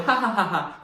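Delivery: high, spelt-out ha-ha laugh; imitated laughter. Laughing at brother's distress, high mock laughter. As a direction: re-enact laughing at the prank. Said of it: "I was laughing, I thought".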